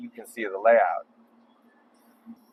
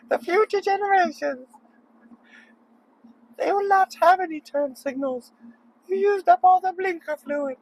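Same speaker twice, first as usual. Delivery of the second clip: Greta Thunberg impression. Driver scolding a motorist about turn signals in squeaky falsetto. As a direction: lecture a bad driver in a squeaky, indignant falsetto. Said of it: "Greta Thunberg".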